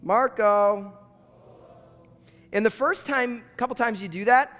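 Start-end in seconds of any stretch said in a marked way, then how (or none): 0.0-0.9 drawn-out pool-game call; calling out Marco Polo. Shown as a demonstration. Preacher leading the congregation in a pool game, calling out. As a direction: call it out to the other players.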